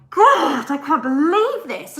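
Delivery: loud, high-pitched outburst of disbelief; retold burst of exasperation; exasperated. Retelling her reaction to the neighbour's complaint, exasperated.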